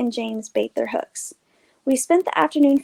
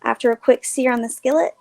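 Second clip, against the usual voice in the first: plain reading voice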